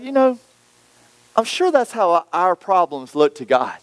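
normal talking voice

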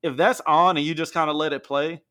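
ordinary delivery

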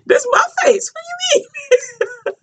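high shout cracking into laughter; hammed-up high yell while laughing. Retelling the face grab, laughing.

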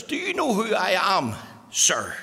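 put-on self-important question in a posh accent. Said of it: "with a posh accent".

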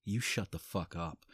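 ordinary talk

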